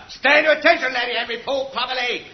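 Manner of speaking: loud, high parade-ground order; barked command. Acted. Sergeant ordering lookout private, barked command. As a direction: snap him to attention.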